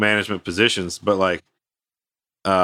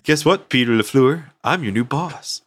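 higher-pitched, playful gloating character voice; Dodgeball movie impression; 5 semitones higher than usual. New boss taunting Peter LaFleur, hammed-up gloating. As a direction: imitate a smug movie rival, pitched up. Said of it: "like in, uh, Dodgeball".

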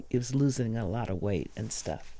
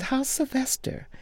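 much higher voiced question from Patti; imitating Patti; 12 semitones higher than usual. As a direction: lift your voice high to ask after a friend.

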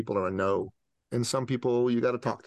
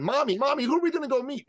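put-on very high, squeaky child voice; mock little-boy excitement, pitched way up; excited little boy; 4 dB louder, faster than usual. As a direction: imitate an eager kid pestering mom from the back seat. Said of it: "All excited".

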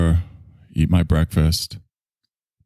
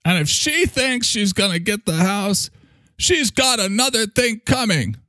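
mimicking the homeless man; 12 semitones higher than usual. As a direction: play the resentful homeless man muttering about his wife. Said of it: "I pass him and he goes".

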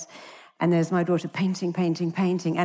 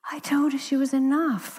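breathy hushed whisper, higher pitched; 6 semitones higher than usual. Retold or imitated. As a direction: lean in and whisper it like a secret. Retold answer to TV anchorman.

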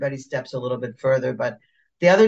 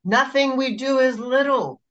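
emphatic protest; 8 semitones higher than usual. Retold or imitated. Retells protesting to facilitators.

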